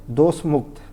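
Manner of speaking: usual speaking voice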